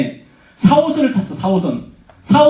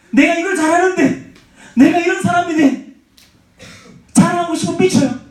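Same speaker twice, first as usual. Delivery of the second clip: raised, higher preaching voice; fervent preaching; 4 semitones higher than usual. Acted. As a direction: drive the point home to the congregation. Preaching with force.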